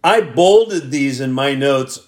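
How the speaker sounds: ordinary talk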